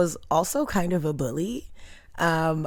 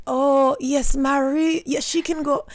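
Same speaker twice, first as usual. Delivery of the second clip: high, slow put-on foreign accent; playing a small foreign mom; imitating a Haitian mother on the phone; faked mom accent; 9 semitones higher, slower than usual. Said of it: "She would do a full accent".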